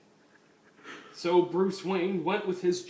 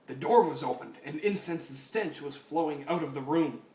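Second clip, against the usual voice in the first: usual speaking voice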